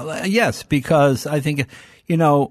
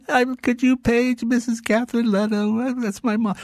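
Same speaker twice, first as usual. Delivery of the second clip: put-on a child asking the manager, higher and sweet; little kid asking; 8 semitones higher than usual. Little [name] asks the store manager to page his mom, childlike. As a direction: play the small boy at the counter. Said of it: "run up to the manager and go".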